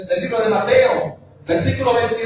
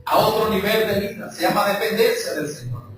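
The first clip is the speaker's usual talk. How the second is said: ordinary talk, nothing marked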